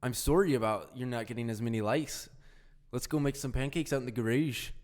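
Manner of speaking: hammed-up northern Canadian accent. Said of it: "super northern Canadian accent right there".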